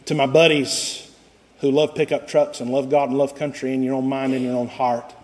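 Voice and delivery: usual speaking voice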